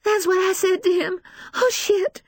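high, frantic tearful account; breathless distress. A narrator's reading. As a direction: tell it in rising panic. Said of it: "She can hardly catch her breath".

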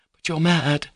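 high, flustered exclamation; nervous protest. Acted. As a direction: call him mad, unsettled. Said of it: "said Lisa nervously".

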